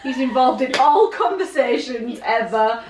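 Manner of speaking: laughing loudly through the words. Real, not acted. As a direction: break into laughter mid-sentence. Laughing about the character.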